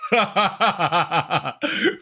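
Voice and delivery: long run of rapid laughs; breathless laughter. Real, not acted. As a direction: burst into a sustained cackling laugh. Laughing after the shot.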